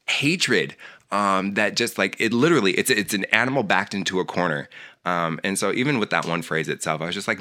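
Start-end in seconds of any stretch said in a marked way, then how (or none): none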